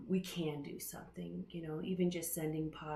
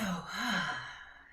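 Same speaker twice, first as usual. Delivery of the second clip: long audible sigh. Real, not acted. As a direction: sigh it out to begin. Settling in before meditation begins.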